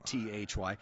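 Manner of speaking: ordinary delivery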